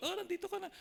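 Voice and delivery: high, soft surprised greeting; surprised girlfriend. Retold or imitated. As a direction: mimic her pleased surprise. Sally surprised at his arrival.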